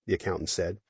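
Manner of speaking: ordinary talk, nothing marked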